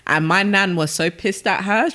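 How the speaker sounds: ordinary talk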